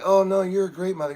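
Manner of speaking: eager reassuring protest; placating. Retold or imitated. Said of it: "I'm like".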